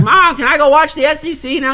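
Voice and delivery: put-on a kid's Southern voice, begging to watch. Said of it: "it was not a bad Southern accent".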